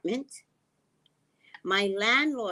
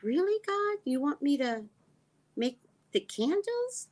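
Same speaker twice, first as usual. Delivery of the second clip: much higher, disbelieving squeak; incredulous; 9 semitones higher than usual. Retold or imitated. Talking to God about making candles, high and incredulous. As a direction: ask God as if it can't be serious.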